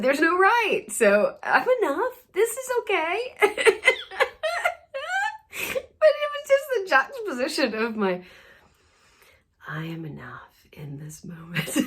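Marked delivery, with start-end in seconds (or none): 3.4-6.0 squealing burst of laughter; real laughter close to crying; high teary laugh. Real, not acted. 9.7-11.8 soft, breathy whispered affirmation; hushed, serene whisper; calm whisper. Shown as a demonstration. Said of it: "Just in this very calm, still place".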